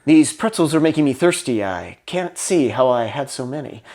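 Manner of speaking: lightly voiced nervous newcomer; overthinking actor. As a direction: mutter the line over to yourself, unsure. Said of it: "get in your own head thinking".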